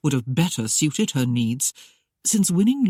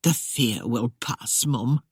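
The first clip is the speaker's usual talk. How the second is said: gentle encouragement; 4 semitones lower, slower than usual. Acted. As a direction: soothe her gently. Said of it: "encouraged gently".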